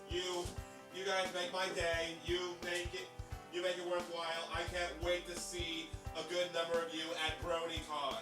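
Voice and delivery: normal talking voice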